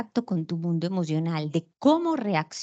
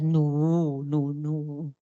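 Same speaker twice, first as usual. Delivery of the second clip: lower, a different voice for the one denying; a low, offended "no" that brushes off the question; denying fear; 6 semitones lower, much slower than usual. Retold or imitated. Acted reply denying fear. (negación fingida en voz más grave)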